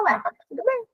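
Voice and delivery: high, soft and breezy "Ué, tudo bem"; airy shrug. Retold or imitated. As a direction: brush it off lightly. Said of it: "eu vou falar". ("ué, tudo bem" leve e despreocupado)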